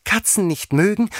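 very high, loud, furious squeal; furious mouse screech; shrieking fury. A narrator's reading. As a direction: shriek it back in outrage. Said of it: "schrie die Maus mit kreischender, wütender Stimme".

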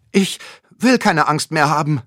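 loud and firm. A narrator's reading. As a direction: stand your ground out loud. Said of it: "sagt Ole laut".

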